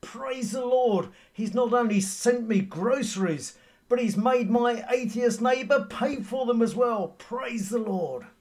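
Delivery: high, loud, exultant cry of praise; jubilant praise. Retold or imitated. Elderly lady triumphing over her atheist neighbour, high and loud. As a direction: shout it out with jumping, clapping joy. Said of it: "with great vigour and clapping her hands".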